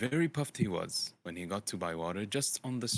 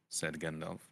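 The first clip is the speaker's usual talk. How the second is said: ordinary delivery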